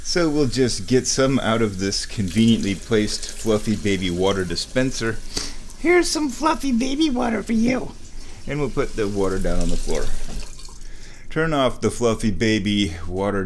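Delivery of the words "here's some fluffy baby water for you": very high pet voice. Real, not acted. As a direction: coo it high to a beloved pet. Serving water to the pet.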